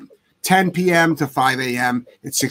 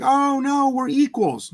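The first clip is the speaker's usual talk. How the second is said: mocking dog owner; 9 semitones higher, slower than usual. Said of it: "And it's like".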